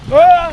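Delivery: sudden loud cry while falling in; a short surprised shout; startled yell. Real, not acted. Falls in while tipping boat.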